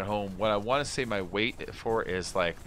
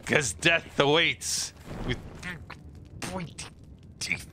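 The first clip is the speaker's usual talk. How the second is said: spooky wizard warning; 4 semitones higher than usual. Acted. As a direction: drag out the threat, fading on the last words. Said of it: "What an eccentric performance".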